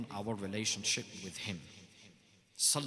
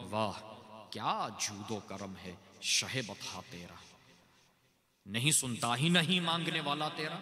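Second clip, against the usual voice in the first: poem recitation. Acted. Speaker to the gathering, quoting Fazil Barelvi: reverent recital. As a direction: recite the couplet like a naat.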